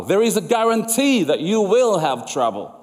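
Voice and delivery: normal talking voice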